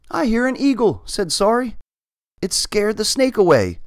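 high-pitched scared cat; nervous little cat voice; frightened, high voice. Acted. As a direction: pitch up, afraid of the eagle. Frightened cat reports eagle.

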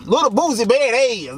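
hammed-up imitation of the rapper announcing himself. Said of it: "I probably do a bad impression of [name]".

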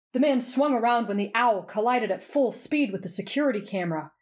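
ordinary delivery

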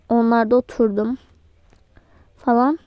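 ordinary talk, nothing marked

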